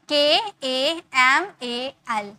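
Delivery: letter by letter, very slowly; dictating letters slowly; slow spelling out. Shown as a demonstration. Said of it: "तो अगर हम इसे लिखेंगे तो कैसे लिखेंगे?".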